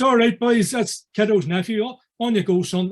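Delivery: mock call to the boys that it's fine; calling out to the group.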